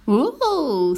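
cow's drawn-out gasp of wonder; delighted exclamation. Acted. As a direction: cry out at a sudden discovery. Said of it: "s'écria la vache".

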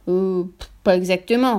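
slow, drawn-out hedging; a father backtracking with a long "euh"; hesitant. A narrator's reading. Father caught out by his boast.

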